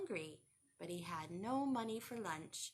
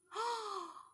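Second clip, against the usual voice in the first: gasp; 10 semitones higher than usual. Acted. Surprise at missing caps.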